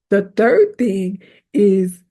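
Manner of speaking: ordinary talk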